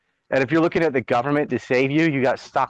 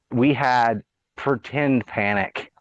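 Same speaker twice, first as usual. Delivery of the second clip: usual speaking voice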